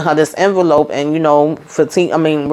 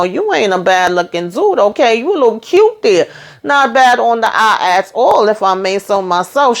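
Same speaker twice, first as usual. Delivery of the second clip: higher, playful flirting mom voice; flirty mama; 6 semitones higher than usual. Retold or imitated. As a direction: tease him like a flirting mother. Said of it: "Mama over here talking about".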